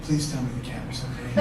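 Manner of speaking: soft, low, concerned request; hushed, earnest pleading; quiet plea. Retold or imitated. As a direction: imitate a worried woman asking softly. Worried audience woman's plea, acted.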